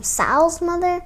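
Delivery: usual speaking voice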